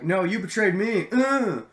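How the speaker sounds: high, loud whiny protest; childish. Retold or imitated. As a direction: whine it like a betrayed kid. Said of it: "that's a lot more childish".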